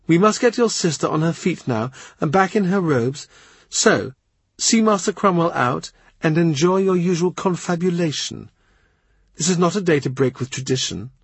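a candid courtier telling hard truths; lightly voiced, tart lady; blunt lady-in-waiting. Rochford giving orders.

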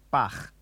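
"Bach" with a scraped German ch; throaty final ch. Shown as a demonstration. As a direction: force air through the end of "Bach". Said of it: "the same as the sound at the end".

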